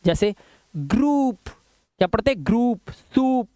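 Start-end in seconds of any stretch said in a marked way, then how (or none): 0.8-1.4 ग्रुप with oo, higher; "oo" sound. Shown as a demonstration. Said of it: "ओयू का उच्चारण उ है". 2.5-3.5 ग्रुप, सूप slowly, with drawn-out oo; "oo" sound. Shown as a demonstration. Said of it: "ओयू का उच्चारण उ है".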